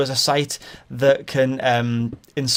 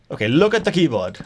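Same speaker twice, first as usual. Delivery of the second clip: higher mock Indian accent; 4 semitones higher, 3 dB louder than usual. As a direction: instruct like an Indian-accented caller, imitated. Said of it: "he definitely had an Indian accent".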